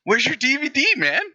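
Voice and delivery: high-pitched pestering stranger; random guy. Acted. Random guy comes up to the actor, high-pitched demand. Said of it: "he's just like".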